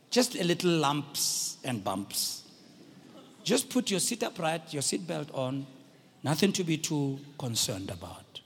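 low, unhurried cabin announcement; calm captain's voice. Retold or imitated. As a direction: imitate a pilot playing down the bumps. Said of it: "he speaks in a calm voice".

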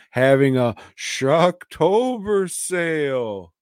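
drawn out, high and loud hype; mock sale announcer. Telling co-host about the sale, mock announcer hype. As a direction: belt out the sale name, stretching it.

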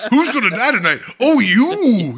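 high, loud mock-mascot voice with laughter; gleefully hammed-up killer catchphrase; Kool-Aid Man. Killer bursting in to pick a victim, Kool-Aid Man style. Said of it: "it's also very Kool-Aid Man".

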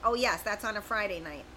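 usual speaking voice

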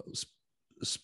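ordinary talk

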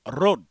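loud "road" with a rolled R; imitated pronunciation. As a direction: mimic "road", trilling the R hard. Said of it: "I love the way he says".

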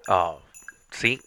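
normal talking voice

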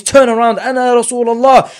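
high, loud, urgent rallying cry; calling out. Retold or imitated. Prophet calling out to fleeing companions, high urgent shout. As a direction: shout over the chaos to turn them around. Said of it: "he's calling out to everybody".

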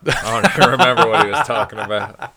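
loud question breaking into laughter; laughing. Real, not acted. Asking what he learned, cracking up loudly. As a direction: laugh through the whole line.

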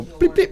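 high mimicked electronic beep; key card beep. Telling his friends about the hotel door, mimicking a high beep. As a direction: chirp it like a door lock.

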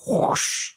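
long breathy whoosh sound effect; imitated whoosh. Fan imitating the Star Wars choke sound, airy whoosh. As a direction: blow out a slow, voiceless whoosh. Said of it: "there's that whoosh sound".

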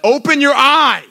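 high, loud, slow "open your eye"; irritated nurse. Retold or imitated. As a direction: drag it out like an impatient nurse. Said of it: "the lady was getting irritated".

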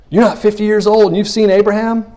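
loud, high incredulous challenge; mock disbelief. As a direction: throw it out as a scoffing, astonished question. Acts out disbelieving challengers of Jesus.

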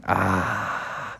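long, soft "ah" of contentment; low, quiet blissful exhale; relaxed sigh. Acted. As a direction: sink back with a low, easy sigh. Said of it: "just chilling back with their arms up".

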